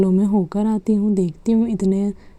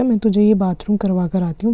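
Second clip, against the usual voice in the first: normal talking voice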